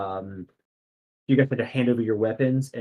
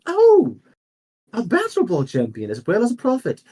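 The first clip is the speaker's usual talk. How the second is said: high, breathless admiration, the last line fast; admiring noble lady; 9 semitones higher, 4 dB louder than usual. Acted. Noble lady flatters the prophet champion, high and admiring. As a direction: coo over the champion, pitched up.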